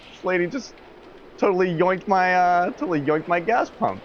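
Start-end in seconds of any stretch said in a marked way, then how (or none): none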